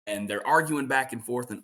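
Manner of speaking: ordinary talk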